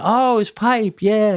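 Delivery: put-on high-pitched little-kid agreement; imitating eager child. Dad voicing daughter adding pipe to drawing, imitating eager child. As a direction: play a kid happily catching on. Acts child taking up the hint.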